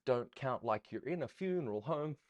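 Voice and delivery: ordinary talk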